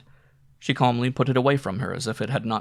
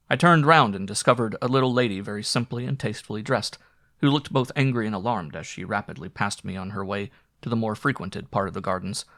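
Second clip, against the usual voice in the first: ordinary delivery, nothing marked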